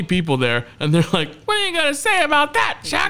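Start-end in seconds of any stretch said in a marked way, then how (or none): 1.5-3.1 very high mock heckler voice; goading crowd. Twenty guests egg on the roasted host, goading. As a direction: imitate onlookers goading in a high pitch.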